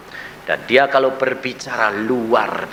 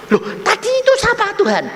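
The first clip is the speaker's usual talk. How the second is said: very high, quick surprise; re-enacted high-pitched bewilderment; astonished question; faster than usual. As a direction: blurt it out in disbelief. Acts out astonished question to God.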